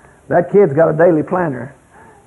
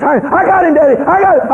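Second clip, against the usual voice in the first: put-on excited child yell; 9 semitones higher than usual. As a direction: crow it like a proud four-year-old. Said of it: "And he was".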